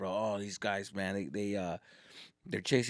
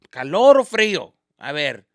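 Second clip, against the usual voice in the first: usual speaking voice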